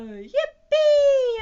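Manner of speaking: high, loud, drawn-out cheer; whooping high with delight; gleeful yell. A narrator's reading. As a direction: yell it out for the whole crowd. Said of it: "everybody yelled".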